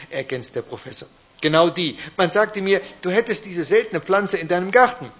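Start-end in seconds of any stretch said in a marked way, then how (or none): 1.4-5.1 high eager little duke voice; a small ruler in a high voice; little grand duke calling. Acted. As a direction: chime in high and insistent. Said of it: "der kleine Großherzog".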